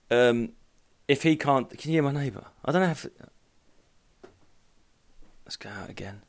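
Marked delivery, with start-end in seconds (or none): 5.4-6.2 breathy, soft whisper. Real, not acted. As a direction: whisper so nobody in the house hears. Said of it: "Just to give the listener context".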